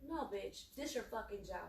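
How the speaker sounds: normal talking voice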